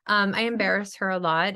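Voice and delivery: ordinary talk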